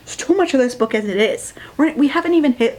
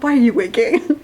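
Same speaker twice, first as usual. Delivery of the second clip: incredulous; 3 semitones higher than usual. Retold or imitated. Reacting incredulously to wink.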